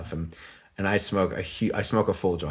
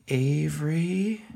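far-off call; 7 semitones higher, 4 dB quieter, much slower than usual. Retold or imitated. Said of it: "I just hear".

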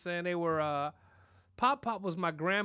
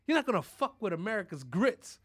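ordinary delivery

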